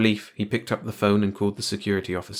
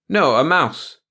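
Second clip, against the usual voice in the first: higher, louder correction; correcting; 7 semitones higher, 7 dB louder, slower than usual. A narrator's reading. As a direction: put the boss right. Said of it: "the old janitor corrected".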